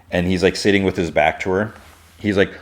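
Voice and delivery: usual speaking voice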